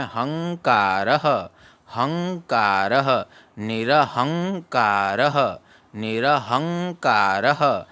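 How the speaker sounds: निरहंकारः slowly, a small break after निर, हंकारः drawn out; निरहंकारः with a pause after नि र. Shown as a demonstration. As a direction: drill हंकारः then निरहंकारः, slow and spaced. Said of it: "नी र के बाद में comma लगा लेना है".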